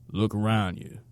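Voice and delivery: ligero acento sureño áspero; un viejo sureño, voz rasposa, levemente marcada; raspy southern twang. Acted. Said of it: "in his raspy southern twang".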